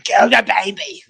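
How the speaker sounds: over-the-top crackhead scream. Retold or imitated. Robber threatening the clerk and baby, mock screaming. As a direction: imitate a frantic robber's scream, hammed up. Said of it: "is literally screaming".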